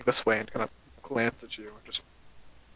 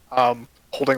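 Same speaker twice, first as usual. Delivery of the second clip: ordinary talk, nothing marked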